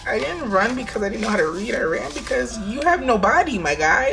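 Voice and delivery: mock defensive. Guest telling bodiless host why she ran, mock indignation.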